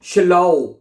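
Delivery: schlau opened with a loud "sh" sound; emphatic model of schlau, starting on "sh". Shown as a demonstration. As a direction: say schlau as "shlow", rhyming with "now". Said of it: "वहां पे शीन की आवाज निकाली जाती है".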